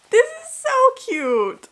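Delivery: very high, slow squeal; delighted squeal. Real, not acted. Unboxing a gift from her friend, high squeal. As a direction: squeal it again, still delighted.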